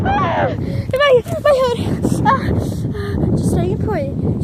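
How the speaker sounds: high loud shrieks; panicked shrieks. Real, not acted. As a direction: shriek as the wind hits.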